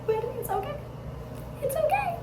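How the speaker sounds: reassuring. Real, not acted. Reassuring herself about dentist anxiety.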